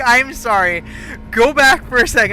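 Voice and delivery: loud, high retold apology; mock protesting apology. Teller interrupting her friend's rant, loud mock protest. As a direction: throw the apology out loudly, amused.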